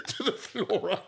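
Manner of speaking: high helpless laugh mid-story; cracking up at the Flora. Real, not acted. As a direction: laugh through the words.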